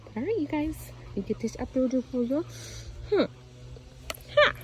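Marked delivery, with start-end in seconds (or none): none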